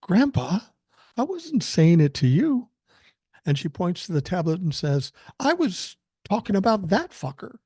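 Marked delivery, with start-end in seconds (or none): 0.0-2.7 put-on high, louder little-girl voice; sincere kid, pitched up; earnest child. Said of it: "with all sincerity and she says". 5.4-7.6 high-pitched imitation of a sincere little girl; earnest child. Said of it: "with all sincerity and she says".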